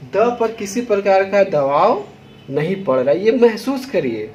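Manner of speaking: usual speaking voice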